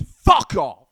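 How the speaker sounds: sudden very loud, drawn-out shout; loud, emphatic dismissal; shouted outburst. Real, not acted. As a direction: explode on the swear words. Blunt outburst at disrespectful people.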